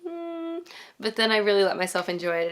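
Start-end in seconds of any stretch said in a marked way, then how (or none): 0.1-0.6 high, soft, held "hmm"; doubtful hum. Retold or imitated. Reviewer recalling the film's first third, doubtful hum. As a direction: hum softly up high, unconvinced. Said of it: "spent the first third of the movie like".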